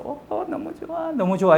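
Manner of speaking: high-pitched mock cries of wonder; retold delighted gasping. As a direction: imitate a wife gushing at the sunrise. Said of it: "보고 막 감탄을 하면서".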